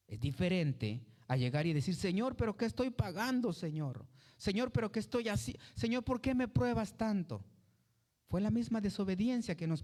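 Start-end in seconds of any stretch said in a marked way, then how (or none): 1.9-7.4 queja aguda y exasperada; reclamo quejumbroso. Retold or imitated. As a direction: imita a quien le reclama a Dios. Creyente reclamando a Dios. (creyente que reclama a Dios, agudo y quejoso)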